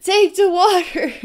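high, loud, urgent calls for water; frantic old man yelling out water; old man's desperate shout. Retold or imitated. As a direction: shout it like pushing water on someone. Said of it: "Maybe that's what that old man is trying to do".

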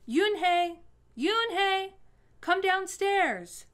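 a mother calling her daughter downstairs. A narrator's reading. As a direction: call up the stairs to her daughter. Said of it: "Mother called up to Yoon-hae".

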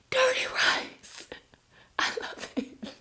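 gasp, then whisper the dish name. Real, not acted. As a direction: whisper it as if savouring a secret craving. Gasps at a fan's dinner.

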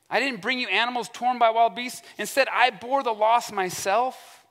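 high-pitched, aggrieved protest; mock crying out. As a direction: plead your case with exaggerated indignation. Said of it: "He cries out all these things".

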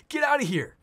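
high, loud get out of here; mock yell. Bipolar bouncer shouting at Ben, mock yell. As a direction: shout him out, hammed-up. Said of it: "we're like".

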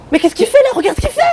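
high, shocked cry. Acted. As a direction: shriek it out in alarm. Shocked at the dog.

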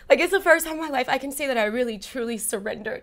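almost crying while remembering; tearful. Real, not acted. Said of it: "I'm gonna cry thinking about it".

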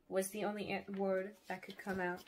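ordinary delivery, nothing marked

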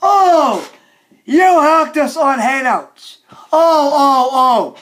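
high, dismayed cries; outraged wailing. Acted. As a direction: wail at the betrayal. Wailing over the hack.

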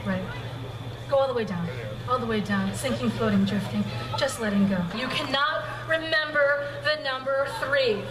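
low, soft hypnotic voice; hypnotist induction. Acted. Hypnotist easing volunteers into deep trance, hushed and low.

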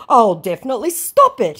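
high and loud promise; hammed-up pledge; mock scammer voice. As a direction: scammer promising to stop, mocked. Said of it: "he says".

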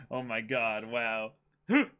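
usual speaking voice

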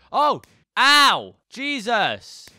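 high, loud startled yelps. Real, not acted. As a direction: jump at the blast. Ghast explosion startles him.

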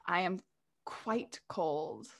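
slow, hushed, deadpan intensity; a little too intense. Acted. Tall rider leans into boy's face, too intense. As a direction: drop it soft and low, word by word. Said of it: "like, a little too intense".

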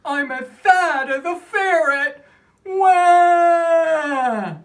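loud, odd voice for the fat ferret; hammed-up ferret. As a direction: play it like a chubby critter. Acting the ferret page.

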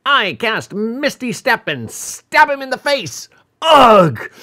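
high-pitched excited shout, ending in a loud grunt; hammed-up interrupting player yell; player's shout. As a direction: blurt it out at high pitch, cutting in on the villain. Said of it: "A player shouts".

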